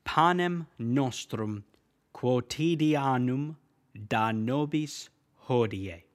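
slow, careful Latin: "Panem nostrum quotidianum da nobis hodie"; teacher modelling church Latin, slow and clear; Latin pronunciation. Shown as a demonstration. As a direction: spell out each Latin word slowly for learners. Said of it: "The way that's said in Latin is".

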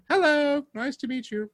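put-on high-pitched little British kid; childhood British accent. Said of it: "I spoke like a Brit".

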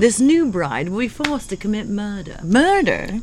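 playful English actress; Emma Thompson British. Pretend. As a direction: deliver it like a British film star. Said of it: "You know who you sounded".

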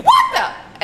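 high startled what-the, laughing; startled yelp. Retold or imitated. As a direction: jump and squeak it. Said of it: "he's like".

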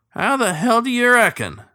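loud, high, sharp snap; snapped. A narrator's reading. Old cook snaps at the captain, loud and sharp. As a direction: bite the words off at him. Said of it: "Early snapped".

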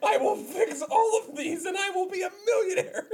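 deluded son bragging through laughs; hammed-up high son's boast; whiny son voice. Continuing the son role.

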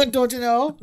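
mock the Minnesota twang while laughing; Minnesota accent echo. Co-host echoes host's Annie line, laughing Minnesota accent. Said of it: "this Minnesota accent".

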